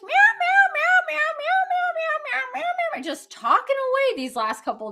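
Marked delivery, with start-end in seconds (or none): 0.0-3.0 high, loud imitation of a chatty cat; sing-song copycat meows, much higher; cat meowing. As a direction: meow over and over like a yappy cat. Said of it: "Jack been totally yapping up a storm".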